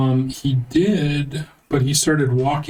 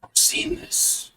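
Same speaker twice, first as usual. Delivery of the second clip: raspy, whispered stone-statue voice; 6 dB quieter than usual. Acted. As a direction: rasp it out like an ancient statue. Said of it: "you hear a raspy voice emanate from both of these statues".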